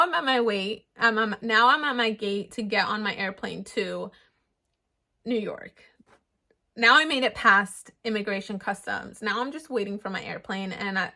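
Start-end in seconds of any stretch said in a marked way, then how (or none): 5.2-5.8 whispered. Real, not acted. Nervous, waiting at the gate.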